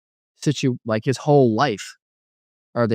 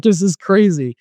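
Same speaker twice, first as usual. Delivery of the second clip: higher, louder exclamation; stunned amazement; 7 semitones higher, 6 dB louder than usual. Retold or imitated. Retelling his reaction alone in the room, amazed. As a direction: burst out in disbelief.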